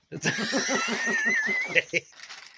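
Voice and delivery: high-pitched burst of laughter. Real, not acted. Laughing with his friend in the shop: high squealing laugh.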